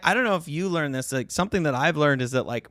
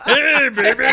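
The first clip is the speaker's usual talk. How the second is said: higher, louder, laughing awkward come-on; nervous sex voice; 11 semitones higher, 8 dB louder than usual. Retold or imitated. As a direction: play the jittery would-be seducer. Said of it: "The nervous sex voice".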